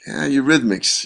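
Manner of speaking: mild, lukewarm musing; unimpressed. Retold or imitated. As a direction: think it to yourself without much enthusiasm. Doubtful thoughts before reaching studio.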